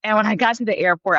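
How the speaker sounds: usual speaking voice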